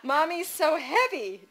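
put-on high, slow, loud little-kid insistence; toddler voice. A narrator's reading. Toddler contradicting mother about her weight, high insistent voice. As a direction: insist slowly like a two-year-old. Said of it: "Dawson said".

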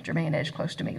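usual speaking voice

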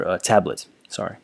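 ordinary delivery

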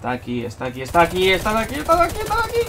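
from tense repeats to a panicked high shriek; rising panic. Real, not acted. Spotting the enemy right beside him, panic building to a shriek.

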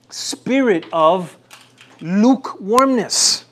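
spirit of lukewarmness said slowly, lukewarmness lifted; drawn-out emphasis. Acted. Pastor telling Laodicea they walk in lukewarmness, slow. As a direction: stretch lukewarmness for weight. Preacher drags out key word.

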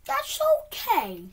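high and loud, voice straining; strained fake calm. Real, not acted. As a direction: force it through a tight squeal. Gamer forcing calm after failure.

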